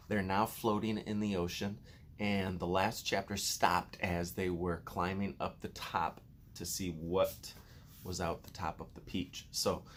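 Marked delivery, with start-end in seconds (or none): none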